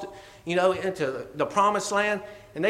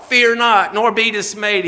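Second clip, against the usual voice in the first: bold command; 3 semitones higher, 7 dB louder than usual. Shown as a demonstration.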